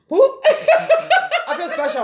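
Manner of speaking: squealing high with laughter; laughing. Real, not acted. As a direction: laugh it out in a mock-proud squeal. Mock joy over gout gift.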